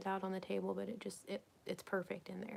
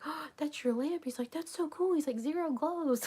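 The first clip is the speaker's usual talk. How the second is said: higher, louder retold delight; husband impressed; 8 semitones higher, 8 dB louder than usual. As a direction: gush as the husband discovering the glow.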